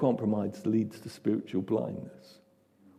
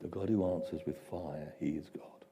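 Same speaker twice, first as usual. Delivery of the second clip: hushed whisper, softer; whispered refrain; 6 semitones lower, 12 dB quieter than usual. Shown as a demonstration. Preacher whispering the refrain to the congregation.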